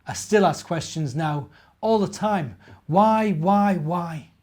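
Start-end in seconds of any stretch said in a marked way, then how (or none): none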